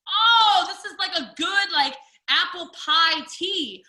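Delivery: high, loud, surprised delight, then slow; a retold wow at the first hot sip; delighted tasting. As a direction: taste it and light up.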